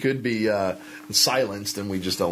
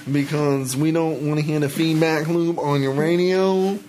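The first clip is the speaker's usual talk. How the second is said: high put-on radio warning; mock radio host; 6 semitones higher, 3 dB louder than usual. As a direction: keep up the pitched-up on-air imitation. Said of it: "Like on the radio when they tell you".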